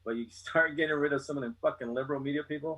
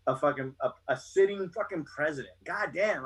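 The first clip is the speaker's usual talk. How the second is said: usual speaking voice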